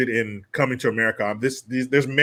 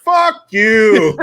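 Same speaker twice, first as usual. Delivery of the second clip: louder, higher mock yell from the back; yelled fuck you; 6 semitones higher, 5 dB louder than usual. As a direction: shout it across the warehouse. Coworker yelling from back.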